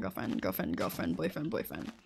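low trailing mutter of relationship labels; mumbled trailing off. Pretend. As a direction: let the labels die away under your breath. Voicing a partner's mumbled labels.

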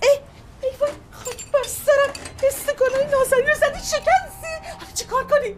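high, wailing dismay. Acted. Aunt wails at her niece over the broken glasses. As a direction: moan over the lost glasses.

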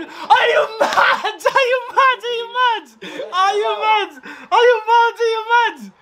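high, repeated outraged cry; incredulous rant. Real, not acted. Fan ranting at the referee, a high outraged repeated cry. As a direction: hammer the question over and over.

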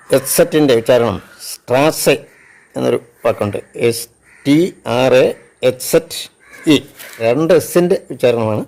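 ordinary talk, nothing marked

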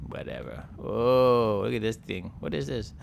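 ordinary talk

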